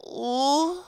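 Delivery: high drawn-out ooh moan; mock moan. Hearing the bombshell's name, a high playful moan. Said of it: "I immediately have to moan".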